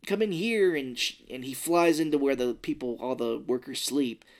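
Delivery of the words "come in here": higher urgent call. Retold or imitated. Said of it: "she's like".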